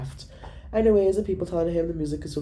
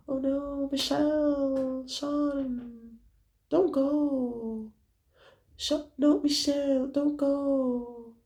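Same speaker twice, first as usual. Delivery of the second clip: higher, slow, softly pleading; soft pleading friends; 9 semitones higher, 4 dB quieter, much slower than usual. Retold or imitated. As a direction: plead weakly for her to stay. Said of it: "Soft voice".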